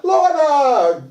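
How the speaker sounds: high, happy call of her name; delighted to see her; excited greeting. Retold or imitated. Greeting friend with a hug.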